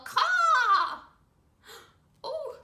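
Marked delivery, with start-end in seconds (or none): 0.1-1.0 loud, high squawk; big drawn-out crow call for kids to copy; bird caw. Shown as a demonstration. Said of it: "Everyone go".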